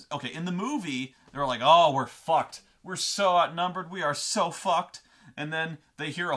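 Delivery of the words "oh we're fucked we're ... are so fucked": loud, hammed-up dismay; raised voice of mock hopelessness; mock despair. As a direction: imitate doomed soldiers lamenting. Movie characters voiced as hopeless, outnumbered.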